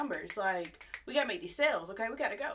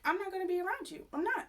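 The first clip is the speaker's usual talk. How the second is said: much higher, quick and incredulous; high indignant; 9 semitones higher than usual. Retold or imitated. Cashier refusing to be near the man who threatened her, high and indignant. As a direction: squeak out your refusal in disbelief. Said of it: "'cause I'm just like".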